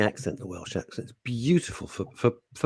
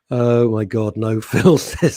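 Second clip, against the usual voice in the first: ordinary talk, nothing marked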